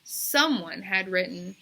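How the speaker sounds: ordinary delivery, nothing marked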